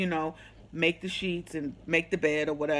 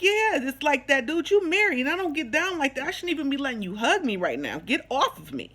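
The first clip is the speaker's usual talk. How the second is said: fast, higher-pitched retold telling-off; indignant retort; 6 semitones higher than usual. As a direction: rattle off an indignant refusal. Retells quarrel with married ex.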